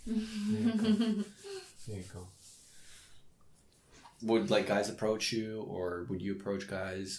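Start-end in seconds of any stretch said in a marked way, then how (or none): 0.0-1.2 put-on cooing baby voice. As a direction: coo it like comforting a child. Giving a hug, cooing.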